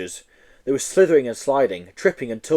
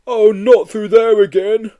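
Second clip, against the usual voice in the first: loud, slow monster groan; groaning Yeti; 9 semitones higher, 9 dB louder, slower than usual. Acted. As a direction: moan it in dread. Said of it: "groaned the Yeti".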